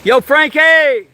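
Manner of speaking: long, high, loud call. Real, not acted. Calling the polar bear out of his cave, slow loud holler. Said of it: "I'm gonna yell to him".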